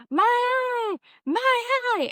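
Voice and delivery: high, loud drawn-out wail; panicked patient. Retold or imitated. Patient screaming about the eye to the practitioner, mock-panicked wail.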